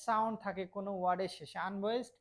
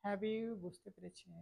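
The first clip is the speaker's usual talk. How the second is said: ordinary talk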